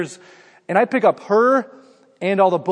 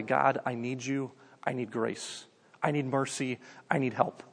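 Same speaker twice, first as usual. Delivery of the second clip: low, soft, slow pleading; hushed, lowered appeal for mercy; humble prayer; 5 semitones lower, 11 dB quieter than usual. Acted. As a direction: confess need to God quietly, pausing between pleas. Humble prayer of the poor acted.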